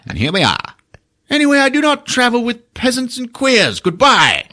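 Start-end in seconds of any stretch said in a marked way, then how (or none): 1.3-4.4 high, snooty dismissal; pitched-up snooty goodbye; haughty wizard. Retold or imitated. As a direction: sniff it like a pompous wizard.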